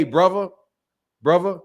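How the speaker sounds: "brother" the British way, with a V for the TH; British "bruvva". Shown as a demonstration. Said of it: "British dudes say brother".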